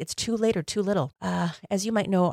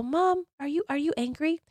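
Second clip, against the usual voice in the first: put-on much higher, small and timid child voice; little girl voice; 11 semitones higher than usual. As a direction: ask like a scared little girl checking on mom. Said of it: "And I would use a little girl voice".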